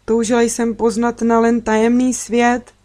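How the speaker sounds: usual speaking voice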